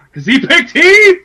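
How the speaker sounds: very high, very loud celebration; elated shout. Real, not acted. Fan learning someone shares his pick, elated shout. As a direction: yell it out in triumph.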